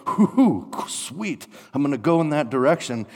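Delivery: high whoop, then eager rush; hammed-up delight; gleeful relief. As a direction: whoop and jump at the offer. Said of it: "you're like".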